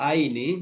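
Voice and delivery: "আইনি" sounded as ai-ni; pronounced "আইনি". Shown as a demonstration. Teacher showing students the dual ending, pronouncing "আইনি" as ai-ni. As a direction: say "আইনি" with an ai glide. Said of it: "উচ্চারণ হবে".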